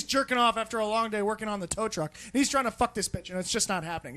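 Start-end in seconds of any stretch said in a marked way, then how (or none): none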